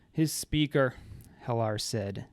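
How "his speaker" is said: bored. A narrator's reading. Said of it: "sounding bored".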